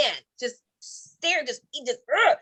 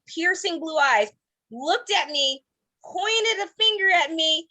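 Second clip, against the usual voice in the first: ordinary talk, nothing marked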